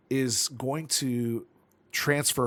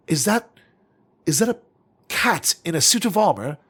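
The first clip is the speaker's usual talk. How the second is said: excited; 7 semitones higher, 9 dB louder than usual. Acted. Character excited at a sighting.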